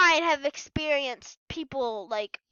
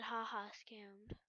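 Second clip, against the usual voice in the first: very soft, slow, low whisper; whispered taunt; 7 semitones lower, 22 dB quieter, slower than usual. Retold or imitated. Retelling what the scammer said, in a slow whisper. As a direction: whisper the mocking line, barely audible.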